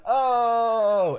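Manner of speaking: high, loud drawn-out crowd "oh"; crowd reaction. Retold or imitated. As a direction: wail like a shocked crowd. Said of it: "the whole crowd freaks out".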